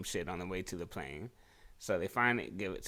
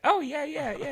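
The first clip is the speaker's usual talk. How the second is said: put-on much higher and louder "Oh, yeah, yeah, yeah" in her accent; exaggerated imitation of her accent; 7 dB louder than usual. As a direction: repeat her accent more hammed-up. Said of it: "that's the way she said it".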